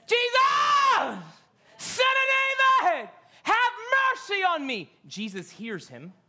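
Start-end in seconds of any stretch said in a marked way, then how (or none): 0.1-4.9 high, loud desperate scream; screaming louder. Acted. Blind man screaming louder to Jesus, desperate yell. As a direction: scream it over the crowd. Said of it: "he screams even louder".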